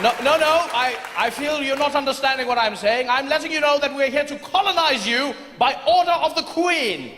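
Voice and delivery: high, indignant British officer; pompous fussy courtier. Acted. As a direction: insist like a flustered official. British soldier insisting.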